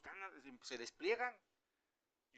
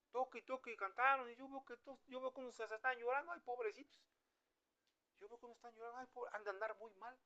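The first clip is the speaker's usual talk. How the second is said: high-pitched mock pity; imitating a detached onlooker; 7 semitones higher than usual. An onlooker watches others crying at worship, in high mock pity. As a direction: play a puzzled bystander in a raised, put-on voice.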